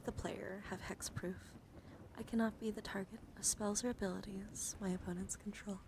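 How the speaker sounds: ordinary talk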